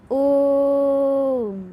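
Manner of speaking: ओम held long on one level note; prolonged vowel. Shown as a demonstration. Teacher showing children a triple-length vowel, ओम drawn right out. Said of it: "तीन गुना समय लगता है".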